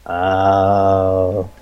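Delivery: low, sinking oh; low disappointed mock oh; deflated oh. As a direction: let the realization drop.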